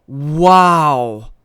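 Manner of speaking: loud, drawn-out wow; floored by what you read; stunned wow. Real, not acted. Reacts to the ban.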